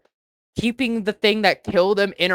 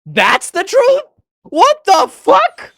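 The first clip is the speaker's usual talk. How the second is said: shocked; 12 semitones higher, 7 dB louder, slower than usual. Real, not acted. Shocked at game reveal.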